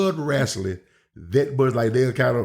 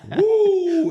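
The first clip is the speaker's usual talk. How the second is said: loud high-pitched Flair-style woo; a showman's shrill victory whoop; high wrestler's whoop; 4 dB louder than usual. Retold or imitated. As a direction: let out a cocky ring whoop. Heel wrestler's signature yell.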